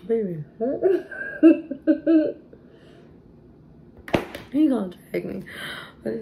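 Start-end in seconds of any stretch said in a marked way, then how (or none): 0.5-2.5 loud high laugh. Real, not acted. Laughs at baby daddy.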